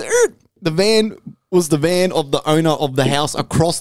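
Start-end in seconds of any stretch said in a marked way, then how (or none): 0.0-0.3 high squealing brakes noise; imitated brake squeal. Mimicking a van braking suddenly, a high screech. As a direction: screech like a van stopping short. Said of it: "The van goes".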